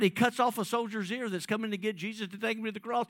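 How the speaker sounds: ordinary delivery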